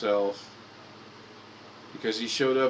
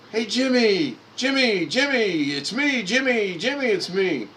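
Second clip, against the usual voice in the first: high, loud calling across the lot; dad yelling out; 13 semitones higher, 7 dB louder than usual. Retold or imitated. Dad waving at James Gardner, calling out loud and high. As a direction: hail a far-off friend at full voice, much higher. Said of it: "he's, he's yelling".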